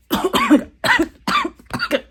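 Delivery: sudden loud, repeated coughing; coughing fit. Real, not acted. Vlogger interrupted mid-quote, loud coughing. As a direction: break off into a hard cough.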